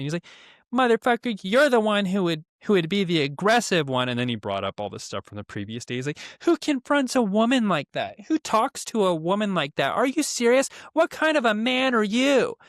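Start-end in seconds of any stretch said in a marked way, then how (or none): 0.7-4.1 high, loud mock outrage easing slightly; imitated screaming. Housemate accusing him of aggression, imitated high scream. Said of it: "he started screaming at me". 6.4-12.6 very high mock-outraged screech; imitated screaming. Housemate berating him over the woman, mimicked screeching. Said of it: "he started screaming at me".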